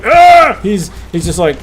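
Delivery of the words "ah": sudden startled yell; shout. Retold or imitated. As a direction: cry out like you've been shot. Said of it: "and he's not like".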